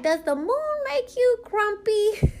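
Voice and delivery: put-on high, slow little-girl voice for the niece; imitating the niece. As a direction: play the small niece asking innocently, high and slow. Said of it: "she was like".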